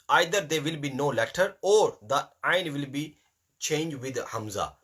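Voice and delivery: usual speaking voice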